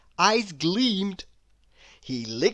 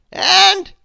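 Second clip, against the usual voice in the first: high, loud, slow wizard voice; stern wizard; 13 semitones higher, 12 dB louder than usual. A narrator's reading. Wizard scolding Mister Mischief, stern and loud. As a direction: loom over the culprit as a stern wizard. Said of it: "he continued".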